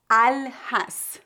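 slow Arabic-style al-has with a breathy h; Arabic word. Shown as a demonstration. Teacher giving the Arabic source word, slowly. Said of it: "vou dar alguns exemplos de palavras árabes".

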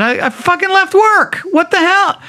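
high, indignant retold yell; high-pitched mock rant; exasperated outburst. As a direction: blurt it out, fed up at being dragged home.

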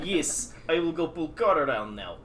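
hammed-up Russian accent; bad Russian accent. Giving Dimitri orders about the car, bad Russian accent. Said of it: "Doing my bad Russian accent".

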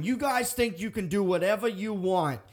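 put-on angry local with a Boston accent. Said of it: "pretty good Boston".

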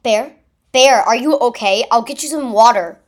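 loud, urgent concern for a friend; worried calling. A narrator's reading. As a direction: call out anxiously. Giraffe worried for hurt friend.

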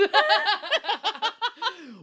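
high loud laughter; real delighted laugh. Real, not acted. Delighted by her cohost's Sondheim joke, laughing loudly.

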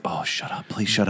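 put-on whispering to the screaming kid; whispered shush. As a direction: whisper it under your breath. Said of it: "And then I was like".

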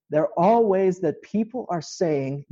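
usual speaking voice

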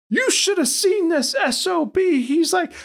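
very high-pitched, hammed-up panic; mock frantic ranting. Boat owner overheard telling dad about rough seas, mock frantic. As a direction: squeal the complaint like a rattled rookie boater.

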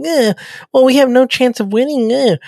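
high-pitched mock whining; whiny complaint. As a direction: put on a high, feeble moan of defeat. Acting out Crusher's complaint.

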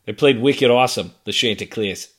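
put-on loud Boston accent, wicked awesome. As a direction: play a Boston sports fan. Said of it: "Chanticleers in a Boston accent".

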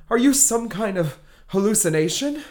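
normal talking voice

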